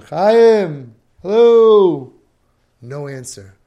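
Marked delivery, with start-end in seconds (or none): 0.0-2.1 loud, high long-distance holler; calling down. Acted. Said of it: "What if you call down".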